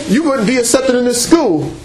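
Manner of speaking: ordinary talk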